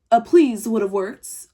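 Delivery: loud, slow, pointed sass; sassy retort. Retold or imitated. Telling the rude girl off, loud and slow with sass. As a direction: drag out please to put her in her place. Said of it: "I was like".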